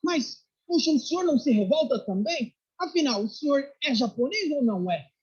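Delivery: high-pitched, exasperated challenge; indignant general. Retold or imitated. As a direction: retell an officer's outraged questioning. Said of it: "esse militar ficou bravo e falou".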